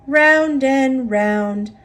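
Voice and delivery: ordinary delivery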